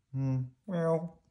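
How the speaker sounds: lightly voiced downcast puppet; resigned, held-out tones; Bert. A narrator's reading. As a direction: let down, pitched slightly up. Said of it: "Bert said".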